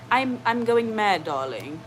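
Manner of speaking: hammed-up British accent, slow and drawn out; worst UK accent. Streamer joking to viewers about her lost cup, bad UK accent. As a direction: put on a bad, theatrical UK accent, voice dropping on the last word. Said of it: "in my, in my worst U K accent".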